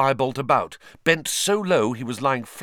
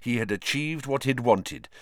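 plain reading voice

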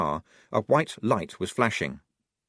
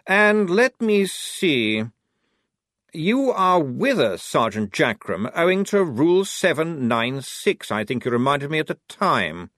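officer voice; 7 semitones higher, 5 dB louder than usual. Acted. Blouse's voice held in narration.

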